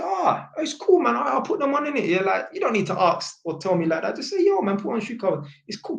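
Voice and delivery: high-pitched, quick easygoing reply; breezy reassurance. Retold or imitated. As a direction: wave the apology away in a high voice. Acting out his easy reassurance to dad.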